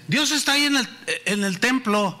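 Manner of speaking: put-on a teen scoffing at the preacher; mocking retort. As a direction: scoff and point at the temple. Boy mocking.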